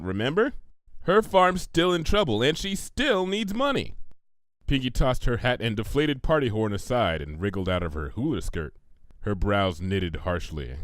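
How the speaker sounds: usual speaking voice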